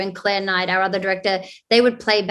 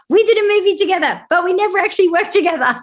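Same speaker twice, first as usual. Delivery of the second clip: high, fast and bright, playfully quoting; playful high quote; 11 semitones higher, 7 dB louder, faster than usual. Retold or imitated. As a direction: toss it off in a quick squeaky voice. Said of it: "connect and say".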